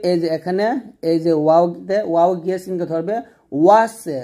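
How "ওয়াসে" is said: ওয়াসে with an added "e" after the s; mispronunciation demo. Shown as a demonstration. Teacher shows students their mistake, ওয়াসে with extra final vowel. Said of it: "এখানে আমরা যে ফুলটা করি".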